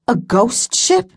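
a hint of fear, rising on ship; surprised. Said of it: "Cable's eyebrows rose".

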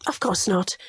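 ordinary delivery, nothing marked

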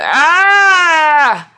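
long, high, loud scream; drawn-out cry of agony; agonised scream. A narrator's reading. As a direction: scream out as the darkness seizes you. Darkness seizes him mid-sentence.

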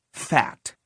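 plain reading voice

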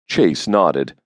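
ordinary delivery

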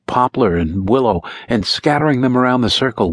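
plain reading voice

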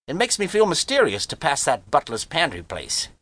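high-pitched brash youngster; cocky boy Harvey. Acted. Harvey chatters about mother and ocean.

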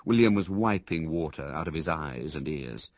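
usual speaking voice

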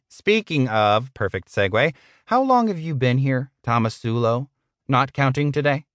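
smarmy manager questioning an employee; condescending boss. Acted.

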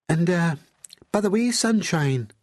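high, seductive posh Glaswegian; seductively posh Glaswegian woman; posh Glaswegian accent. A narrator's reading. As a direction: purr it high in a refined Glasgow accent. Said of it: "in that seductively posh Glaswegian accent".

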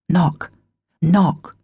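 ordinary delivery

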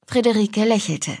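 ordinary delivery

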